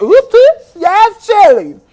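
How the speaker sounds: high, very loud whoops; starstruck squeal. Retold or imitated. Fan slipping over for her idol, loud high squeal. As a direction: shriek it like an ecstatic fan. Re-enacts starstruck reaction to singer.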